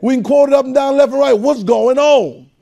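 high, loud, rising preacher's call; full-force preaching. Real, not acted. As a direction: preach it hard to the room.

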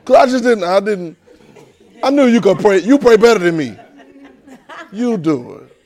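high, loud, laughing plea; pleading child. Acted. Boy coaxing his dad to pray instead, pleading. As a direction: play up a kid wheedling out of it.

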